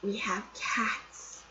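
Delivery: slow, soft whisper; hushed announcement. Retold or imitated. Friend reports a discovery of cats, hushed whisper. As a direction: whisper it, drawing the words out.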